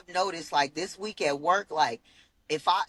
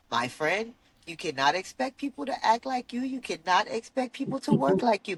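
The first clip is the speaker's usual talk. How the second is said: imitating a boss's Indian accent, raised pitch; 6 semitones higher than usual. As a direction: Indian accent, higher and friendly. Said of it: "in her Indian voice".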